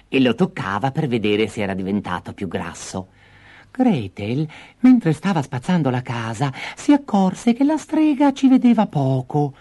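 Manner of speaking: ordinary delivery, nothing marked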